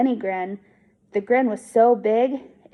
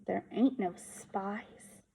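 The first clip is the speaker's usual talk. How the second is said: softer, slow, mulling it over; quiet echo; 11 dB quieter, much slower than usual. A narrator's reading. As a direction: let the words sink in, softly and slowly. Echoing the words to himself.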